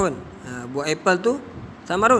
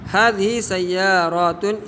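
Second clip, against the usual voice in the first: slow model phrase; 2 semitones higher, slower than usual. Shown as a demonstration. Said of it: "Ini ialah beberapa kereta".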